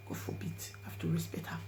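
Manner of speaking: hushed reverent whisper. Real, not acted. Narrator whispering respect for her dead friend. Refusing to play voice note.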